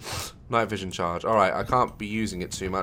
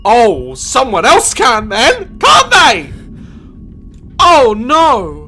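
high, loud, slow panicked squeal; terrified shriek; 13 semitones higher, 12 dB louder, much slower than usual. Real, not acted. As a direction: squeal in horror. Terrified at the bell ringing.

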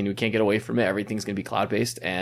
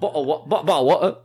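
high, stumbling mock-British attempt; louder, faltering fake British accent; 7 semitones higher, 4 dB louder, much faster than usual. Shown as a demonstration. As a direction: keep retrying a bad British accent. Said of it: "in a British accent".

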